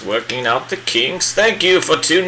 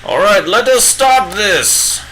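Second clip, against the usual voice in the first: higher, hammed-up British old wizard; bad Gandalf impression; 7 semitones higher than usual. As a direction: play a pompous old wizard, badly on purpose.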